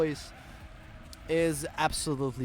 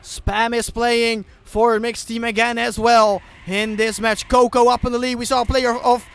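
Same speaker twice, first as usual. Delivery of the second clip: loud, higher live commentary; excited play-by-play; 8 semitones higher, 10 dB louder than usual. Real, not acted. Caster on the deciding map, loud excited play-by-play. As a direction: call the race with energy.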